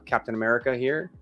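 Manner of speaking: ordinary talk, nothing marked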